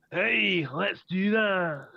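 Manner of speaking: put-on hammy fantasy dwarf accent; Dwarvish accent. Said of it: "In, uh, Dwarvish, I'll go".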